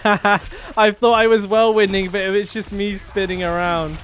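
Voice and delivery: loud, laughing through the words, slow; cracking up as you say it. Real, not acted. Laughing at his own mistake.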